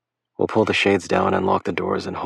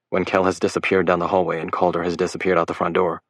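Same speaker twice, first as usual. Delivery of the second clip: usual speaking voice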